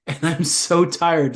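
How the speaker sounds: slow, drawn-out hammed-up tiredness; mock weary heroine. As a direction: stretch the words like a badly acted sleepy girl. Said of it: "she says these things out loud".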